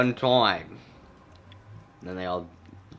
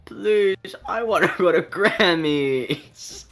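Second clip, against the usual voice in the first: higher, louder and very slow begging; mock pleading child; 8 semitones higher, 5 dB louder, much slower than usual. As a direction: plead theatrically to dearest father. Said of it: "Oh, dearest father".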